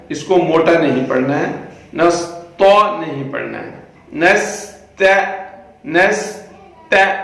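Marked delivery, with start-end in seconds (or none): 4.1-7.1 نست with a light thin ta. Shown as a demonstration. Teacher showing the thin ta. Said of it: "تا باریک ہے".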